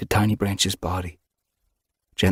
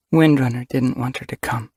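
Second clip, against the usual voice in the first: ordinary delivery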